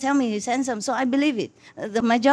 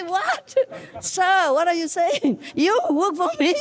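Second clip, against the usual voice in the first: mock surprise; 8 semitones higher than usual. She reacts to the billionaire.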